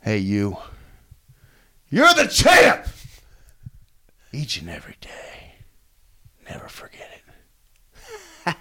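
roaring hype then hushed whisper; wrestling promo. Shown as a demonstration. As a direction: fire yourself up, then whisper it. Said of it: "cut that promo that you cut on yourself".

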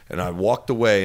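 normal talking voice

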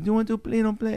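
put-on high, half-hearted Israeli kid accent; lightly voiced Israeli boy, pitched high; bad Israeli accent. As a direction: try an Israeli accent and admit it fails. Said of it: "I can't do an Israeli accent".

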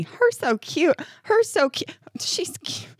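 imitating baby talk, rising into a squeak; mocking baby talk. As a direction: coo it up like a doting stranger. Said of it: "it's that one for me".

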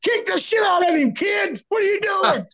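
high shouted referee yell; 1 ref yelling. Retold or imitated. Referee yelling at a young wrestler, high shout. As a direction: bark it at the kid from outside the ropes. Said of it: "Sonny goes".

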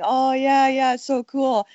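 slow, mildly hammed-up late-comer gush; lightly mock the fans who jumped in too late; mock wannabe. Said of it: "the wannabes after the fact going".

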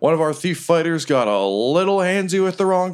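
high, booming fight-night announcer; ring announcer. Acted. GM as Riker opening the fight to the crowd, announcer voice. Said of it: "And he's like".

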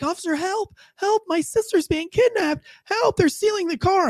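high-pitched mock cry for help; panicked old woman. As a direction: imitate a frantic elderly woman, way up high. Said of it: "she's, like, eighty years old".

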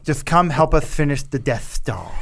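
put-on Australian lisp; lispy Australian. Podcaster imitating the actor pleading for Death Star help, lispy Australian. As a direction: lisp it as an Australian film villain. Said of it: "an Australian accent with a lisp".

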